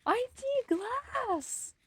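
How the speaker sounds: very high, slow, offended whine; high squeaky protest. Acted. Protests about not seeing glass.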